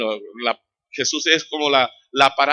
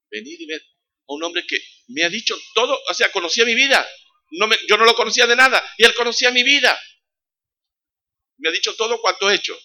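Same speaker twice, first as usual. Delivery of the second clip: higher and louder, astonished telling; amazed woman; 9 semitones higher, 7 dB louder than usual. Acted. Samaritan woman to friends, amazed outcry. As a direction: burst out with wonder at being known. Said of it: "se quedó tan sorprendida".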